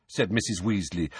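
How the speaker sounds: usual speaking voice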